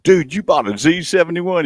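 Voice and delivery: father impressed by son's Z71 purchase; amazed dad. Retold or imitated. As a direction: marvel at the son's new truck.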